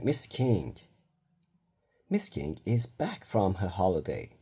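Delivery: ordinary delivery, nothing marked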